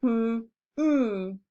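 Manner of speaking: two short hummed beats; humming the beats. Shown as a demonstration. Teacher hums out coming for the class, two short hums. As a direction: hum out the syllables. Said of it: "I've tried the humming method".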